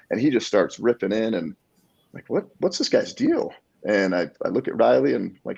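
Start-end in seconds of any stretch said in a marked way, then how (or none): 2.3-3.5 high, puzzled inner reaction; retold bewilderment. Wondering about the ranting stranger, bewildered. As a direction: re-enact being baffled, pitched way up.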